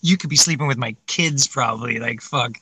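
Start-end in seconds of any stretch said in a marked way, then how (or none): none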